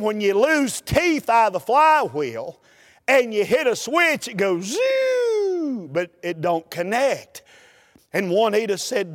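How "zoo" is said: long high whining zoo, drawn out slowly; starter whine. Retold or imitated. Preacher imitating his dead starter motor with a high whine. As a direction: sing out the whine of a starter that won't catch.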